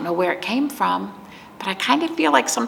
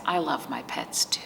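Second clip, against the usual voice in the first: whisper; 4 semitones lower, 8 dB quieter than usual. Real, not acted. Confiding to children about pets.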